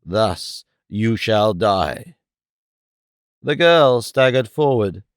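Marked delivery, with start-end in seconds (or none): 0.0-2.2 slow, low, menacing; a deadly slow threat to the killer; terrible hiss. Acted. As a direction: drag out each word like a blade. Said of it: "he hissed in a terrible voice".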